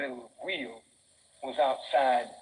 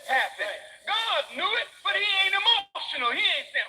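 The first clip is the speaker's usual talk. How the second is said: preaching climax; 10 semitones higher than usual. Acted. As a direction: hit the top of the sermon.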